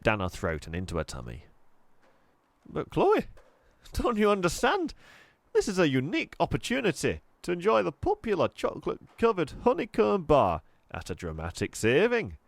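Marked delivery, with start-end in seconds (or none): 2.7-12.4 high, pleading salesman push; eager sales pitch. Acted. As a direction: beg her to grab the bargain. Raj pleading his sales offer.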